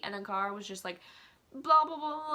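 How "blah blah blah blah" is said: mocking blah blah. Mocking Cara's constant chatter.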